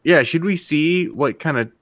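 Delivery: normal talking voice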